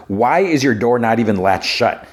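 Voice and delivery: normal talking voice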